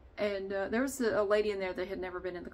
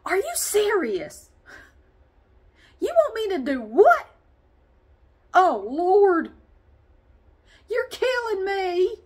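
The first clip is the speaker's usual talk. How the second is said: much higher, louder, slow groaning complaint; imitating the exhausted lady; 11 semitones higher, 9 dB louder, slower than usual. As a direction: ham up the lady whining at every exercise. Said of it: "she would go".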